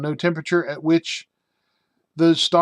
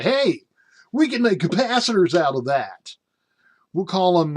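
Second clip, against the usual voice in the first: higher-pitched eager mock executive; excited company pitch; 4 semitones higher, 4 dB louder than usual. Company men who actually found hell, excitedly pitching. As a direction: act out a delighted corporate brainwave.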